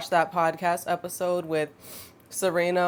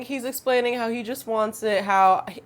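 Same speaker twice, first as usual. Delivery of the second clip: usual speaking voice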